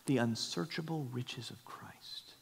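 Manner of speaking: slow hushed whisper; whispered, slow and reverent. Real, not acted. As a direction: breathe it out in awe.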